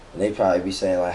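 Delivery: usual speaking voice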